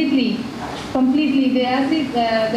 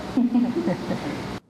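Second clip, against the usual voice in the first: a brief, shy real laugh; short laugh; 5 dB quieter than usual. Real, not acted. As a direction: laugh it off lightly. Shy laugh after her accent remark.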